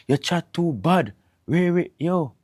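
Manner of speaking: high, drawn-out disapproving rebuke; mock scolding. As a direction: act out a scandalised telling-off. Acted scolding about children speaking patois.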